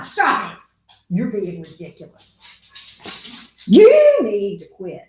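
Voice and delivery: slow firm telling off; scolding. Real, not acted. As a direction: scold the dog slowly. Scolding the dog.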